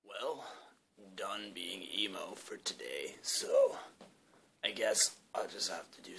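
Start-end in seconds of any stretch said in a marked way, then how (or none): 0.1-3.1 whisper. Acted. Dressed as emo character.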